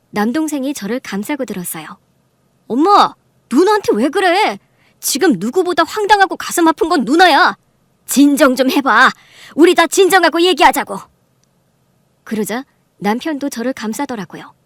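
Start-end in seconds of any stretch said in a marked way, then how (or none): 2.7-11.0 loud, raised-pitch appeal; heated protest. Acted. Brother defending his sister to their mother, heated. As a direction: stick up for her forcefully.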